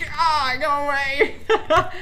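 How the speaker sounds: high, loud, slow scared yelp with a laugh. Real, not acted. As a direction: shriek it away laughing. Startled by a monster.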